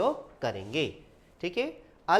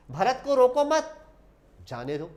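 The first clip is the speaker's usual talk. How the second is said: group रोको मत, break, then drop on जाने दो; pause after मत; 5 semitones higher, 5 dB louder than usual. Shown as a demonstration. Teacher shows how meaning changes, pausing after मत. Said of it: "मत पर लगा दूं".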